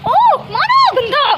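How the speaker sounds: loud, very high yelp; scolding yell. Real, not acted. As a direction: cry out at the misbehaving pet. Yelling at pet that hits. (ज़ोर से चीखकर डाँटते हुए)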